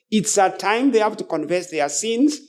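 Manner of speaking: usual speaking voice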